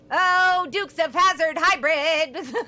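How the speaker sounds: loud high laugh at own joke; real laughter. Real, not acted. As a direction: crack up at the joke.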